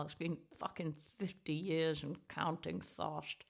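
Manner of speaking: normal talking voice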